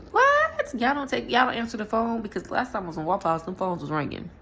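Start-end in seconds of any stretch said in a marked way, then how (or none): 0.1-2.2 high, loud what, y'all serious; shocked disbelief. Retold or imitated. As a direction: sound floored by it. Shocked at no phone orders.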